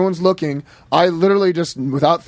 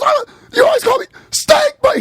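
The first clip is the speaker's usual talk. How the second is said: high squeaky shout, much higher; squeaky scream. Retold or imitated. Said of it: "he had this squeaky voice".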